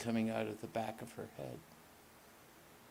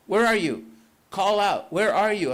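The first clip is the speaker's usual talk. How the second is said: calling out; 8 semitones higher, 12 dB louder than usual. Acted. Searching in smoke for a voice.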